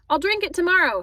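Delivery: high, loud parting call; shouted. A narrator's reading. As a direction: shout it back while leaving. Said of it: "he shouted".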